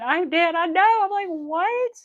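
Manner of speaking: high, loud amused disbelief; amazed, rising exclamation; incredulous outburst. Real, not acted. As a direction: climb into a baffled, loud "What?". Reacting to the absurd scam line.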